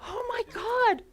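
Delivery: very high, squealed astonishment; high-pitched mock shriek of wonder; retold amazement. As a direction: squeal it high in imitated excitement. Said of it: "I was like".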